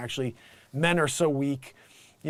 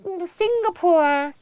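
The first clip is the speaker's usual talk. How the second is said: very high, slow baby-doll imitation; tiny put-on voice, dragged out; mocking little voice; 13 semitones higher, slower than usual. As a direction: mimic her in a silly squeak. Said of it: "why she's got that little".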